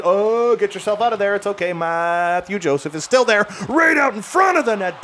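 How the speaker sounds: climbing pitch, urgent hockey call; urgent play call. Real, not acted. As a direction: call out a dangerous chance. Anxious call as chance develops.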